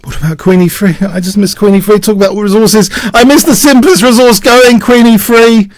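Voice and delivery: high, loud outburst building to a shout; exasperated self-reproach. Real, not acted. Player kicking himself over the missed simplest resource, shouting. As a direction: berate yourself at the top of your voice.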